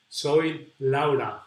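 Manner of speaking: Soy Laura, slowly in Spanish pronunciation; Soy Laura with slow Spanish vowels. Shown as a demonstration. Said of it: "with the Spanish pronunciation".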